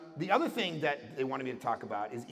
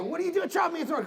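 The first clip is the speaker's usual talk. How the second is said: indignant victim; 5 dB louder, much faster than usual. Retold or imitated. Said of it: "And when they go".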